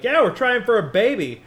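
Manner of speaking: sing-song sendup of proud parents-to-be; mock couple announcement. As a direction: parody telling the in-laws brightly. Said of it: "looking at your partner's parents and be like".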